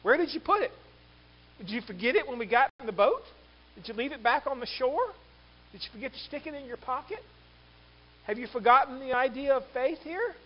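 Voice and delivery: high, rising string of questions; pointed questioning. Acted. Jesus quizzing disciples about lost faith, pointed questioning. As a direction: lift each question as if quizzing them.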